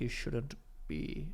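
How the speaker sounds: mock slow, low, hushed delivery; Alan Rickman. Co-host mocks the actor's slow speech, low and hushed. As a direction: crawl through the words very quietly. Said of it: "He's like".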